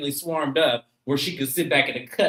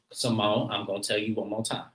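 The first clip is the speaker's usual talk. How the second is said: ordinary delivery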